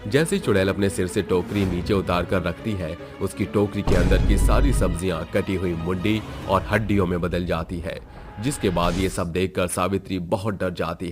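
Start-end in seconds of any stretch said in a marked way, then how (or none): none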